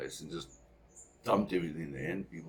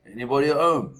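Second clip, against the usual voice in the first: loud high call into the empty shop; shouting out; 8 semitones higher, 12 dB louder than usual. Retold or imitated. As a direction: call out to whoever is in the back. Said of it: "my dad shouts out".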